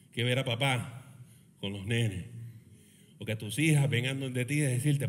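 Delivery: usual speaking voice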